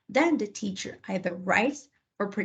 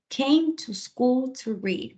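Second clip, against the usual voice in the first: slow dictation; 5 semitones higher, slower than usual. Shown as a demonstration. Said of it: "I like to slow it down".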